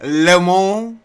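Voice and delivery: "Lemon" said as French "lemo", rising; French accent. Pretend. Mocking the defendant's name in a French accent. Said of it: "with a French accent".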